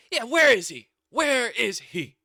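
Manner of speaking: high, loud taunting yell, slowing at the end; mocking shout. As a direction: taunt the crowd, hammed-up. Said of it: "C M Punk's like".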